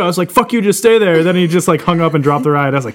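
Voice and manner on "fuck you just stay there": raised, higher-pitched retold outburst; mock-angry, voice jumping up; aggressive retort. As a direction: recount an exasperated phone order, pitched up. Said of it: "It was a lot more aggressive than that".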